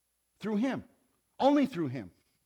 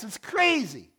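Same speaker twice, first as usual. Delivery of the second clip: ordinary delivery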